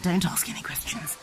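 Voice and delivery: soft whispered aside; whispering. Real, not acted. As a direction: whisper it as a secret.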